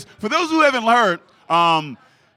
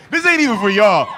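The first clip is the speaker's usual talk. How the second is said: normal talking voice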